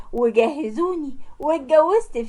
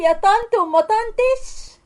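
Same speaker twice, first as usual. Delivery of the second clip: "يا طنط" mimicked much higher and slow with a twisted mouth; mock the word "طنط" in a sneering, drawn-out sing-song; sneering imitation of "يا طنط وما طنطش", higher and slower; mocking mimicry; 8 semitones higher than usual. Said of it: "هتعوج بقك كده عليها وتقول".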